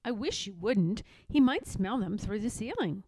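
plain reading voice

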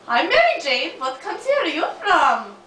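put-on clipped Russian-accent greeting; heavy put-on Russian accent. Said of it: "Why do you have Russian accent".

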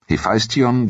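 ordinary delivery

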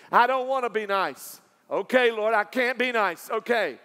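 fervent sermon build; preaching. Acted. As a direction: preach it out with full force. Preaching at full force.

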